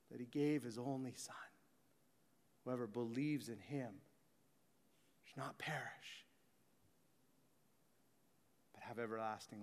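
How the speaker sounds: low, soft, half-whispered preaching; whispered sermon. Acted. Preacher softly telling the congregation of Christ's sacrifice, whispered. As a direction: hush it like a secret.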